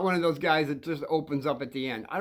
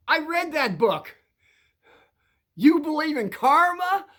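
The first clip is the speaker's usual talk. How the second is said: voiced passenger; 10 semitones higher, 5 dB louder than usual. Passenger voiced in cab story.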